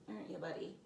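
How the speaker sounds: ordinary delivery, nothing marked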